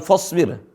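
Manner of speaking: "fasbir" said as "fosbir"; wrong thick fa. Shown as a demonstration. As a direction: round the first vowel of "fasbir" towards o. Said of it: "Bukan fasbir".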